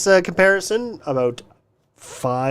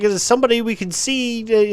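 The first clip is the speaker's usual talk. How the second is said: ordinary delivery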